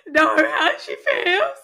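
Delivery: high, slow, laughing half-sob; fake-weep it while laughing; mock crying. Host retelling the movie preview, laughing through mock tears. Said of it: "I'm like".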